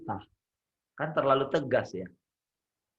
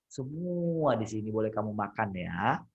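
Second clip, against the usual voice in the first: softer, lower, kindly voice; gentle teaching; 6 semitones lower, 4 dB quieter than usual. Shown as a demonstration. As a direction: explain it tenderly to a child. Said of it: "Ngajar, lembut gitu kan".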